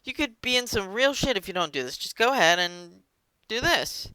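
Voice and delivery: high, smarmy pitch; fake-friendly salesman. A salesman pretending to be Vlad's friend, high and smarmy. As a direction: sweet-talk him like a fake buddy. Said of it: "he pretends he's his friend".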